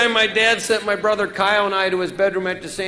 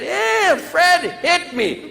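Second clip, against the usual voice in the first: whiny little brother; 6 semitones higher, much slower than usual. Retold or imitated. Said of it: "He goes running off to our dad".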